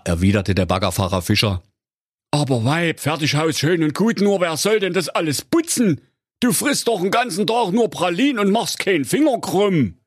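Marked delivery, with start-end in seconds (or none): 2.3-10.0 high, put-on voice of a grumbling husband; henpecked husband. A narrator's reading. Digger driver complaining to his wife, high grumbling husband voice. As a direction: grumble at the lazy wife in a raised voice.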